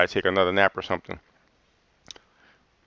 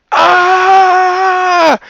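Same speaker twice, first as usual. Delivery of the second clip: long, loud, high-pitched mock scream; drawn-out screech imitating a fed-up debater; imitated scream; 9 dB louder than usual. Retelling how Sean drowned out the debaters, imitated scream. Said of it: "He just started screaming".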